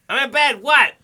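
high, loud mock-mom answer; put-on annoyed phone pickup; irritated mom. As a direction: blurt it like a mom woken by a call. Echoes the mom impression.

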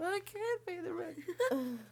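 slow high falsetto wail, laughing; damsel in distress. Retold or imitated. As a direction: wail it like a melodrama heroine. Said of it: "Snidely whiplash".

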